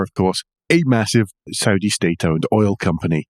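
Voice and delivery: ordinary talk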